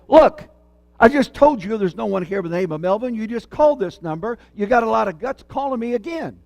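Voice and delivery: irritated man snapping into the phone; angry man on the phone telling off a repeat caller. Retold or imitated. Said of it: "That's anger".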